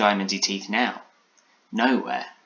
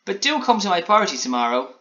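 high, louder, perky invitation; Alan Taylor cheering up; 10 semitones higher, 5 dB louder than usual. A narrator's reading. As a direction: invite brightly as a little gingerbread man. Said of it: "he said, cheering up".

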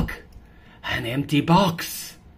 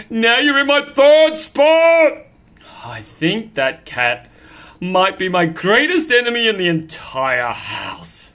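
loud, slow complaint about the other cat; grumbling, then declaring an enemy; annoyed cat; 10 semitones higher, 11 dB louder, slower than usual. Acted.

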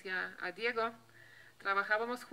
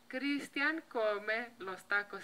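slow learner sentence; much slower than usual. Retold or imitated.

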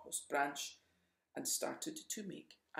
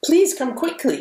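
urgent plea; 11 semitones higher, 22 dB louder than usual. Acted. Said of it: "knocked on her door".

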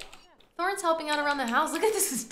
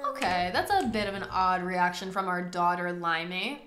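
ordinary delivery

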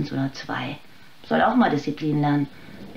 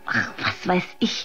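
bitter little laugh; 5 dB quieter than usual. Acted. Weary mother breaks off laughing.

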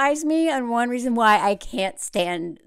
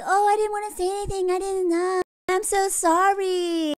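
much higher, sugary mock apology; 8 semitones higher than usual. Mocking Laura apologizing to Sarah, higher sugary voice. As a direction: play a fake-sorry Southern belle, higher.

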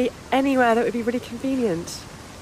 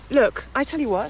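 usual speaking voice

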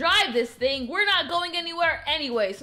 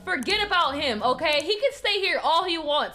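ordinary delivery

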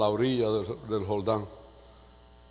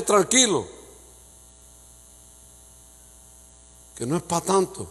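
calm reassurance; 4 semitones higher than usual. Acted. God answering the pastor calmly.

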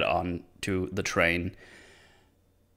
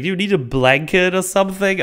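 high, loud sing-song mock politeness; a fussing waiter offering the dying woman peanuts, with mock politeness; mock caring host; 9 semitones higher, 5 dB louder than usual. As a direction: overdo the sweet, eager helpfulness.

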